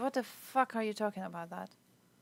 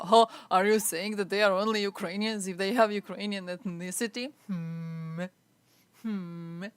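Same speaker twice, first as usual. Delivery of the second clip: louder, mocking put-on voice of the chatter; mocking the commenter; 5 dB louder than usual. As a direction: parody the commenter's smug question. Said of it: "and you go".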